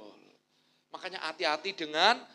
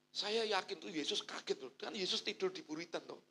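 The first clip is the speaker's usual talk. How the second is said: ordinary talk, nothing marked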